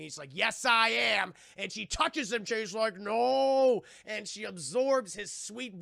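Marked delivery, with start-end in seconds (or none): 0.3-1.3 loud retold boast; insistent mock yell. Villain insists in retold fight. 3.0-3.8 loud, higher, drawn-out no; mock cry of no. Victim cries out in retold fight.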